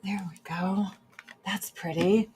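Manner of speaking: soft unvoiced whisper to herself. Real, not acted. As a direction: breathe it out as a whisper. Finishing makeup, whispered.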